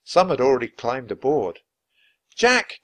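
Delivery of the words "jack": high, loud call from afar; cry out. A narrator's reading. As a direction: call his name from the boat. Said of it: "came a cry".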